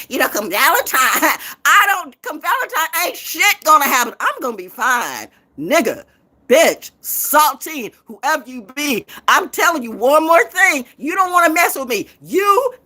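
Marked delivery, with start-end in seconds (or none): 5.6-12.7 angry warning. Real, not acted. Threatening rant at rivals.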